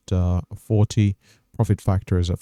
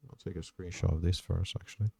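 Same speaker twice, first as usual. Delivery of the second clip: much softer, said half to himself; muttered aside; 14 dB quieter than usual. Real, not acted. Trader pausing the recap to grab a screenshot, muttered. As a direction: drop the voice to a private note-to-self.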